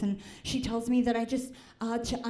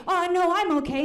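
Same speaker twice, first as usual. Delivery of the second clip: higher and louder, forced through pain; strained in pain; 7 semitones higher, 6 dB louder than usual. Retold or imitated. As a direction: insist you're fine while it hurts. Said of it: "I have to say".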